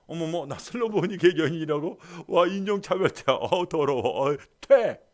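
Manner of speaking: high, loud offended scoff ending in a spit; mock indignation. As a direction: huff off in insulted disgust. Woman mockingly offended. (모욕당해 비꼬며 침 뱉는 여인)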